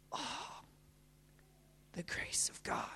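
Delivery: hushed whisper of wonder; barely audible, breath-only awe; awed whisper. Real, not acted. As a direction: whisper it in reverence. Awed repeat, whispered then voiced.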